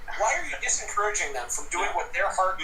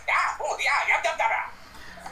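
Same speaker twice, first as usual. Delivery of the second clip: excited Ewok chatter; 4 semitones higher, slower than usual. Acted. GM voices Ewoks chattering.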